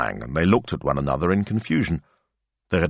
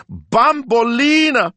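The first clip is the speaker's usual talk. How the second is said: very high, loud, stretched cry of the dog's name; cried out; 7 dB louder, much slower than usual. A narrator's reading. Signor Rossi leaping up at his dog's name, crying out. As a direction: cry out slowly in alarm. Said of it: "he cried".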